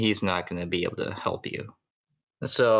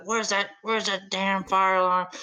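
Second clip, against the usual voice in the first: much higher mock angry father; hammed-up furious search for the alarm; angry dad; 10 semitones higher than usual. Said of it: "And he goes".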